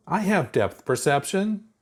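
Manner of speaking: a touch of high, slow protest; lightly voiced indignant girl; mild protest. A narrator's reading. Chuck defending her eyes, mildly protesting. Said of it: "Chuck protested".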